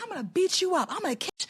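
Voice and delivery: high-pitched mimicked threats; mock threat, high and not shouted; mocking imitation.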